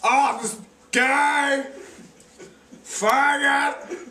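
high, loud, slow jeering; mocking shout. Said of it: "That's what my brother Brody did".

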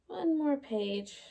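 ordinary delivery, nothing marked

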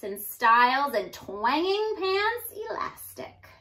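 lively storybook read-aloud voice; animated teacher reading a rhyming book aloud; reading to children. A narrator's reading. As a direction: read it like a bouncy picture book for kids. Reads picture book to children.

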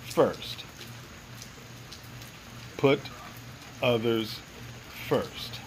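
low, measured, word-by-word message; neutral inner voice. Retold or imitated. Inner voice giving its message over and over, low and neutral. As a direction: intone it softly with long pauses between words. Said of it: "came across as a neutral".

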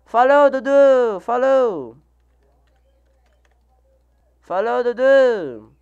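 saying goodbye to a viewer, cheerful. Real, not acted. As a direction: call out a bye.

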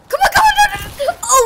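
high, loud frantic urging, easing into relief; panicked shouting. Real, not acted. Player barely surviving the scariest moment, panicked shout. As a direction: shout through the panic till you make it. Scary moment, then success.

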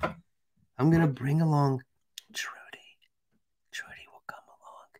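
hushed secret reveal; conspiratorial whisper. Pretend. Streamer whispering to chat about bringing Trudy along. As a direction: whisper the surprise guest's name.

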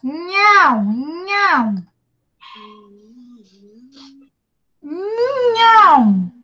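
NHÃO drawn out on its nasal nh sound; nasal syllable. Shown as a demonstration. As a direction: hold the nasal start of "Nhão", then open to ão. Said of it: "é uma sílaba nasalada". ("nhão" nasalado e alongado)

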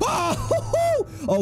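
high startled laughing hoots. Real, not acted.

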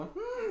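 high-pitched judging "mm"; mm burlón. Retold or imitated. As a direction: squeak out a disapproving hum. Acts out snooping at a replier. (mm agudo de desdén)

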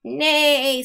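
slow, loud whinnying neigh; whinny like a horse. Acted. Reading to kids, a slow loud horse neigh. Animal sound in picture book.

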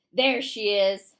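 loud, slow shout. A narrator's reading. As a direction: bellow it at the teacher. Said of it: "Trout shouted".

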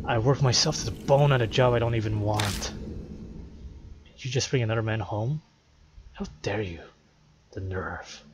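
low, quiet, worn-out man; depressed man. Acted. Exhausted husband about his cheating wife, depressed and bitter.

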